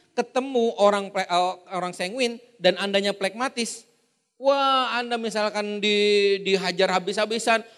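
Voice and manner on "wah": usual speaking voice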